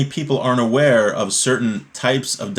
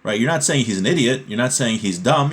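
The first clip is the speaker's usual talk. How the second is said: ordinary talk, nothing marked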